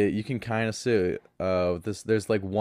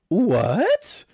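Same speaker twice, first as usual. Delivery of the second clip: incredulous; 7 semitones higher, 3 dB louder, slower than usual. Real, not acted. Incredulous rant at ignorant people.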